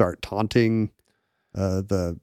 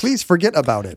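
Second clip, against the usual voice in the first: politely softened catchphrase; well-mannered New Yorker; 3 semitones higher than usual. Acted. Well-mannered transplant toning down the catchphrase, mock polite. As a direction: make the catchphrase a courteous request. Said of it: "the well-mannered ones".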